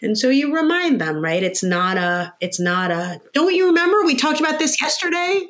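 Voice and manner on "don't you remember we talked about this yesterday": exasperated scolding. Retold or imitated. Parent snapping at a toddler who forgot, exasperated.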